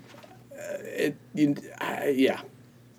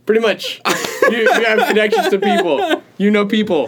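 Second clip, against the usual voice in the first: high giggly teasing; laughing; 10 semitones higher, 5 dB louder than usual. Real, not acted. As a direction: laugh through the words. Teasing about being a big deal.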